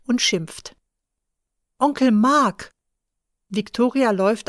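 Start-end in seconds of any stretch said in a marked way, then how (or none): 1.8-2.7 calling out. Acted. Calling after running uncle.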